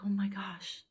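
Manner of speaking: astonished whisper. Retold or imitated. Suddenly sober in the cell, whispering in disbelief. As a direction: hush it out in disbelief.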